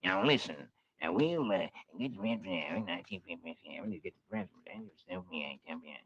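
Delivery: low, soft conspiring buzzard; scheming buzzard. Acted. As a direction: hush it like a secret plan. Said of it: "Brer Buzzard has a scheme".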